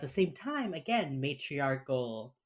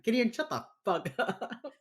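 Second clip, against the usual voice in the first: exasperated; 9 semitones higher than usual. Retold or imitated. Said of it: "that I'm like".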